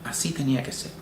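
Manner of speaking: soft, hushed voice in the ear; whisper. Retold or imitated. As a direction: breathe it close to his ear. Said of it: "un susurro al oído que me dijo".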